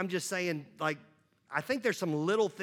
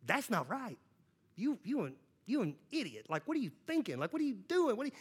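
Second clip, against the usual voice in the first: mild mock scolding; 3 semitones higher, 7 dB quieter than usual. God scolding him by day's end.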